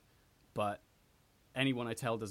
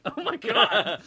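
high, loud laughing exclamation; 11 semitones higher, 13 dB louder, slower than usual. Real, not acted. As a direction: laugh out the words in disbelief. Reacting to her accent.

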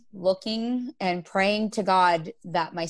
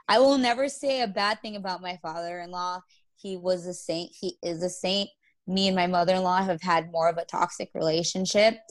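normal talking voice

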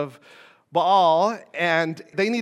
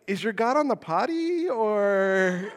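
teasing, higher-pitched jab ending in a laugh; mocking Elijah; 4 semitones higher, slower than usual. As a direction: needle them like Elijah mocking the prophets. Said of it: "Elijah's like".

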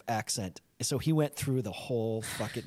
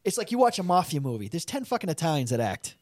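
ordinary talk, nothing marked

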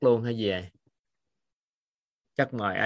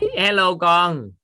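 high, slow, sing-song hello; 6 semitones higher, 6 dB louder, slower than usual. Real, not acted. Teacher greets a small child.

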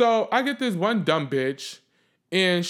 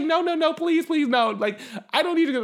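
high, insistent begging; imitated pleading; 10 semitones higher than usual. Lady begging her not to fight on the train, imitated pleading. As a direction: plead as a worried bystander.

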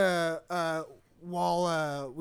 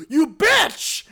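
high, loud mock yell after a gasp; enemy spotting player; 9 semitones higher, 12 dB louder than usual. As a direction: hurl it as an over-the-top angry shout. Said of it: "there's, like, two or three versions".

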